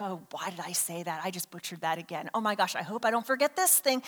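quick, hushed self-doubt; little inside voice. Acted. Preacher voicing own thoughts while preaching, quiet inner fretting. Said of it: "that little voice that's inside".

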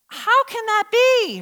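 high, drawn-out disbelief; puzzled old priest questioning an angel; incredulous question. Acted. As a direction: stretch it out, baffled and high. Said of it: "He's like".

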